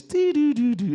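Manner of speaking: high, loud sing-song hum imitating the ceremony music; humming the music. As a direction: hum it like chiming frequencies. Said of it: "He's got the music playing".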